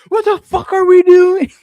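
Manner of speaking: put-on very high, loud child's copycat yell; imitating five-year-old. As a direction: squeal it out like a kid copying an angry adult. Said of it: "my five-year-old jumps up and goes".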